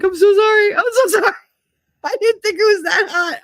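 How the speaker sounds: imitating him in a high, pleading squeal; panicked apology. Imitating the prankster apologizing to her, high panicky voice.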